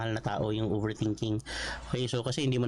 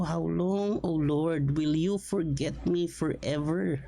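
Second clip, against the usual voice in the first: pleading, anguished plea to God; agonized prayer; 4 semitones higher, slower than usual. Retold or imitated. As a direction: cry out like a man wrung dry. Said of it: "sincerity at yung agony nitong prayer".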